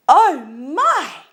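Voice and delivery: high, loud cry of alarm; startled cry. A narrator's reading. Goldilocks wakes to three bears, loud startled cry. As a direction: cry out on waking with a fright. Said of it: "cried Goldilocks".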